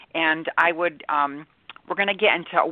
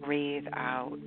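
guided meditation; 3 dB quieter than usual. Acted. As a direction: ease them into calm, softly. Guided meditation over music.

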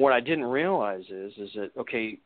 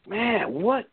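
slow, drawn-out, higher plea; weary plea; 8 semitones higher, much slower than usual. Retold or imitated. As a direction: drag it out like you're worn down. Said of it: "it's just like".

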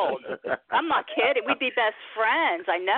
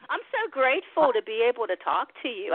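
normal talking voice

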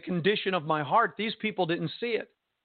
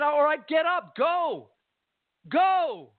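retold exasperated shout; 11 semitones higher, slower than usual. Pushing away from belligerent man.

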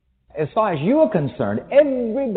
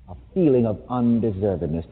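normal talking voice

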